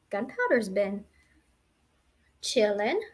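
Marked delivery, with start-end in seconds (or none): none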